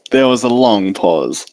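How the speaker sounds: ordinary delivery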